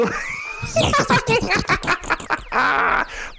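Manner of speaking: shrill cackling laugh; squealing falsetto laugh; high diva cackle. Acted.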